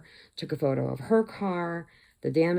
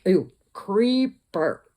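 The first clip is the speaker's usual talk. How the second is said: slow, drawn-out, disgusted "ew"; 4 semitones higher, 6 dB louder, much slower than usual. Retold or imitated. Teller reacting to the creepy man on screen, slow and disgusted. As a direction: recoil in mock disgust. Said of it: "And I'm like".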